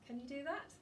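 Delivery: ordinary delivery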